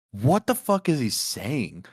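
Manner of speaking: loud, drawn-out bewildered question; baffled outburst. Retold or imitated. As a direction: blurt it in exaggerated disbelief. Retold baffled reaction to guy.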